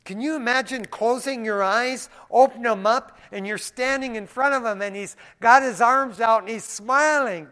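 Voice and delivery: loud, high, impassioned preaching; preaching at full force. Real, not acted. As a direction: lift the crowd with the vision. Preaching builds to a climax.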